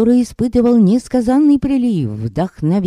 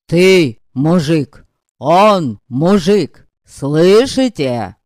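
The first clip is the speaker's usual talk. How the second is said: louder, slow, insistent proclaiming; slow loud declaring; 5 dB louder, much slower than usual. Acted. Pan Zusek points at the clerk, loud and slow. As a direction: hammer each word at the crowd.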